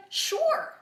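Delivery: very high, loud chirp of consent; eager agreement. Retold or imitated. Husband's willing reply, acted out.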